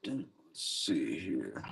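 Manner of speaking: slow whisper to himself; muttered aside while checking cards. Real, not acted. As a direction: breathe it out slowly under his breath.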